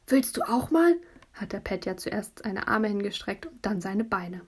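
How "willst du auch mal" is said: eager question. Acted. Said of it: "offering to be checked".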